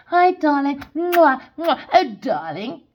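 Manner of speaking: a hint of a gushing celebrity, air kisses; mocking, sugary; mock sweet talk. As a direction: send up fake darling-talk. Mocks fake celebrity sweetness.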